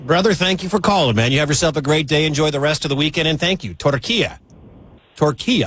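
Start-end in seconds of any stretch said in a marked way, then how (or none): 3.7-5.7 careful try at Turkiye as tor-KEE-ya; practicing Turkiye pronunciation. Shown as a demonstration. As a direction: repeat Torquilla like a learner copying the caller. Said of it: "he can be taught".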